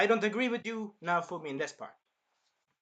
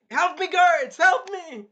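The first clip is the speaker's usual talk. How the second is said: much higher and louder, panicked princess screaming; shrill cry for help; 12 semitones higher, 13 dB louder, much slower than usual. Retold or imitated. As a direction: scream for the guards like a spoiled princess. Princess calling for guards.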